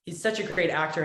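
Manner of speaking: ordinary delivery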